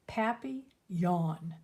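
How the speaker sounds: "papillon" said slowly, as pappy-yawn; French pronunciation demo. Shown as a demonstration. Nancy teaching how to say "papillon", slow French pronunciation. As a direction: draw "papillon" out in separate syllables. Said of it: "You say it like this".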